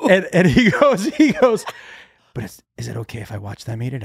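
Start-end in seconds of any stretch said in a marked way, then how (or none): 2.3-4.0 very soft, low conspiratorial murmur; hushed question. Retold or imitated. Oldest son asks to watch siblings eat it, hushed. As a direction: ask it secretively, much softer.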